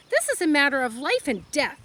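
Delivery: high, loud, outraged protest; a daughter shouting at her father over the axe; indignant yell. Acted. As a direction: argue for the pig's life at full voice. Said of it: "yelled Fern".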